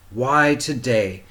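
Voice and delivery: ordinary delivery, nothing marked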